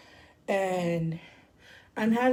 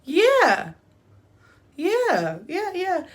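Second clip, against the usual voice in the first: mock eager agreeing; 9 semitones higher, 5 dB louder, much slower than usual. Absent-minded agreeing acted out.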